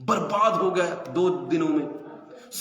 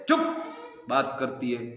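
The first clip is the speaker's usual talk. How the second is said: normal talking voice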